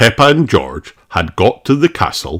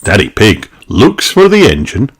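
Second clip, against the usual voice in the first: plain reading voice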